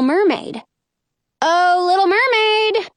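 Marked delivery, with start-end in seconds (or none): 1.4-2.9 calling out. Acted. Girl calling out to the sea for the mermaid, sing-song. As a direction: call across the water to find her. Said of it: "I call".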